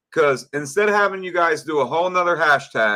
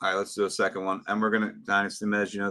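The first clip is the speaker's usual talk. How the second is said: ordinary talk, nothing marked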